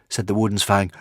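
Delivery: ordinary delivery, nothing marked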